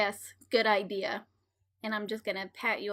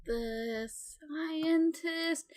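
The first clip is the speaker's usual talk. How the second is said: very slow, higher questioning repeat; drawn-out echo; 5 semitones higher, 4 dB quieter, much slower than usual. Acted. Hero echoes the villain's answer, slow and drawn-out. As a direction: stretch each word out in mock puzzlement.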